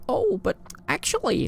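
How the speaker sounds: high, nasal-free mock American know-it-all; mock American accent. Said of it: "Did you like my American impression".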